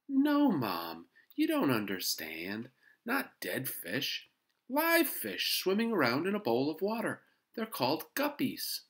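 put-on high, loud kid correcting his mom; eager boy explaining. A narrator's reading. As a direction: set Mom straight, eagerly.

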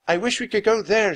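high, loud wish; shouting. Acted. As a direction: shout it over the wind. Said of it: "she shouted".

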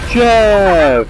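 loud high call. Real, not acted. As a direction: call out his name. Calling the pet.

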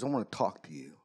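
low, quiet gruff growl; big bad biker, gruff and low. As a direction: imitate a gruff biker, pitched well down. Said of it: "in his gruff voice".

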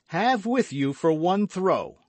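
high, loud exclamation; loud, high-pitched giving in; bold acceptance. A narrator's reading. D'Artagnan gives in to the wager.

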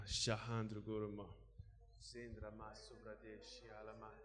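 soft, murmured prayer in tongues; praying in tongues. Real, not acted. Preacher leading the congregation in tongues, murmured. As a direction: let the tongues flow quietly, eyes closed.

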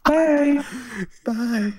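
put-on high girly goodbyes; girl voice. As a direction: keep the cute girl voice going. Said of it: "say bye to everyone in your girl voice".

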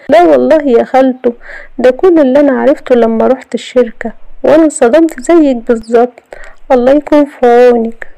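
put-on high-pitched young woman feigning sympathy; scheming niece. Niece reporting to her aunt.

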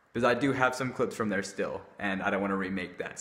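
normal talking voice

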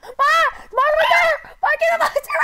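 high, loud terrified screaming; panicked scream. Real, not acted. Monster chasing in horror game.

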